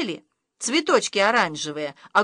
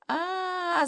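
high panicked wail; mum's scream; 9 semitones higher, 5 dB quieter than usual. A narrator's reading. Mum shrieks at the warning about cold. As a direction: shriek in alarm. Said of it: "закричала мама".

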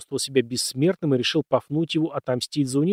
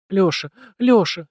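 sobbing, slow and louder; crying on the phone; 6 semitones higher, 5 dB louder, slower than usual. A narrator's reading. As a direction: cry out his name through tears. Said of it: "надрывно всхлипывала в трубку".

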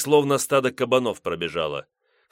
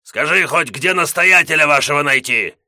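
loud, higher shouted question; full voice; 6 semitones higher, 9 dB louder than usual. Acted. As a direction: call it out at full voice. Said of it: "уже в полный голос".

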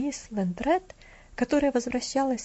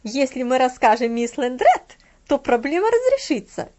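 sarcastic; 8 dB louder than usual. A narrator's reading. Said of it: "с сарказмом в голосе".

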